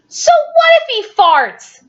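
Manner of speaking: put-on high cheeky child voice; defiant kid. Parent voicing Billy shrugging off the farts. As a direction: toss it off like a bold little boy. Billy quote in read story.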